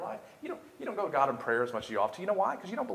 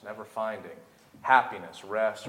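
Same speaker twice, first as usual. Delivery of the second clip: normal talking voice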